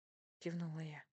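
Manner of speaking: ordinary delivery, nothing marked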